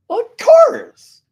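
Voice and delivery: high squeaky mock voice, slow; mocking his younger self's obvious answer; mock falsetto. High falsetto mock to the mentor, drawn out.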